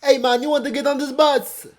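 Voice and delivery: high, loud mock Jamaican accent; amateur Jamaican accent. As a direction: call out like a tout in a badly faked Jamaican accent. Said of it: "how to do a Jamaican accent".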